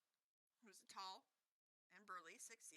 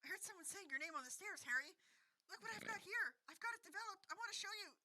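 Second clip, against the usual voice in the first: higher, eager, breathless fan; excited kid voice; 11 semitones higher, 4 dB louder than usual. A narrator's reading. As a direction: gush like an eager young fan. Excited Colin chattering.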